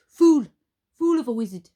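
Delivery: put-on loud, high Scottish-accented bark; attempted Scottish accent. A narrator's reading. Spoon scolding the wizard in a Scottish accent. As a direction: berate him in a shaky Scottish accent. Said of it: "supposed to do a Scottish accent".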